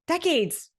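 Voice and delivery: high exclaimed decades; excited. Real, not acted. Podcaster to listeners, exclaiming high. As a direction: throw it up high with delight.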